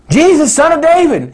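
crying out. Acted. Blind Bartimaeus calling to Jesus over the crowd, crying out. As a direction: cry out for mercy over the crowd.

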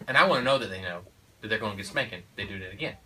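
usual speaking voice

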